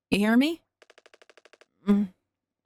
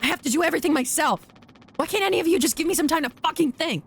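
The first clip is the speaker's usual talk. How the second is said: frustrated rant; 6 semitones higher, faster than usual. Real, not acted.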